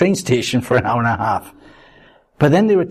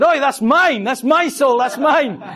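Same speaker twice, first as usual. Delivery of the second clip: high-pitched, indignant calling out; indignant protest; 10 semitones higher than usual. Retold or imitated. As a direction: stake your claim, voice pitched right up. Acted indignant protest over saved soul.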